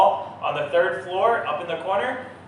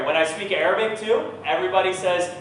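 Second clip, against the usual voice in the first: normal talking voice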